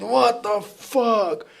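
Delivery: slow, high drawn-out what the fuck; disbelieving. Retold or imitated. Hearing a course cost thousands, slow high disbelief. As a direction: stretch it out in mock disbelief.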